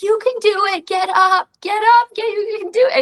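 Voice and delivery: put-on high, childlike cheer of encouragement; little kids cheering. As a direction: squeak it out like a small fan rooting from the crowd. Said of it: "I've had these little kids who's like".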